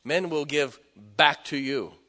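slow, loud, high pulpit delivery; full-force preaching. Acted. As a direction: thunder the verse out slowly to the congregation. Preacher quoting scripture loudly.

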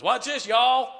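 a high, loud cocky boast. Retold or imitated. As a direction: show off to your friends. Boasting kid before the ride.

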